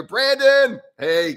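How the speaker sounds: loud, high-pitched shout of a name; calling out a greeting. Real, not acted. Host greets a viewer joining the stream, calling out.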